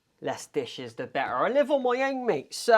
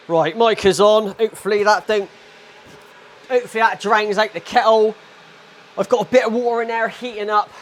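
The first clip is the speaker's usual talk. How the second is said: raised voice to beat the kettle noise; loud over the kettle; 5 semitones higher, 11 dB louder than usual. Real, not acted. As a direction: project over a boiling kettle. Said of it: "boiling is gonna overpower my, uh, voice".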